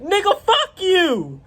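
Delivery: high, drawn-out mock outburst; retold angry retort. Driver cursing back at the neighbor, acted angry retort. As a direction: throw it back high and slow, acting out a quarrel.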